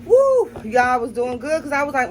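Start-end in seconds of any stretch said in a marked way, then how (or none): 0.0-0.5 high, loud whoop at the burn; real spicy whoop; heat whoop. Real, not acted. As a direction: let out a burning whoop. Spice growing.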